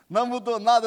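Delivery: loud mock gruff husband; hammed-up loud husband retort; blunt husband's retort. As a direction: bark it like a stubborn old husband. Mocking husband's blunt reply.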